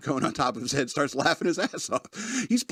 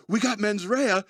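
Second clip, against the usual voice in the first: higher-pitched retold disbelief; mild mock alarm; 7 semitones higher than usual. Wilder's character repeats the words he just lip-read, mildly alarmed. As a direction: imitate someone taking in alarming news. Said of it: "He starts flipping out".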